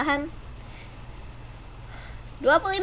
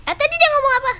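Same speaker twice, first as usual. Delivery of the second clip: high, loud puzzled ask; surprised question; 12 semitones higher, 7 dB louder, much faster than usual. Real, not acted. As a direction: sound baffled by what he said. Puzzled by customer's words.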